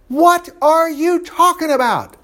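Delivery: high, slow outraged demand; demanding king. Acted. King to Esther after her plea, demanding outrage. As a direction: pitch it up and stretch it in royal disbelief. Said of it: "King Ahasuerus demanded".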